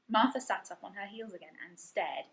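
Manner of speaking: ordinary delivery, nothing marked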